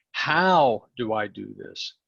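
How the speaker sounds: the question spoken slowly and deliberately; slow it right down and weigh each word; slow demo. Shown as a demonstration. Coach slowly models the key question for the group. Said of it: "this is the word that matters".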